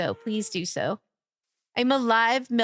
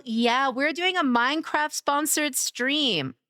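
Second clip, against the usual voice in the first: ordinary talk, nothing marked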